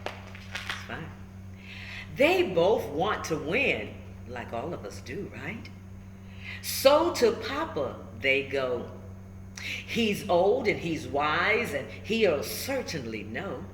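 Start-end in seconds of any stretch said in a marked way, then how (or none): none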